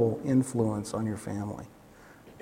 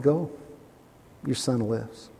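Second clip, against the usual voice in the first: soft, quiet and gentle; tender assurance; 3 semitones lower, 8 dB quieter, slower than usual. Retold or imitated. Jesus looking the father in the eyes, tenderly. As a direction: reassure him face to face. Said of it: "looking him right in the eyes".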